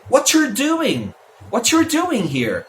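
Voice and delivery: What you're squeezed into whatcher, doing drawn out; reduced native pronunciation. Shown as a demonstration. Teacher showing another native form, What you're reduced to whatcher. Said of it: "Muitas outras vezes é".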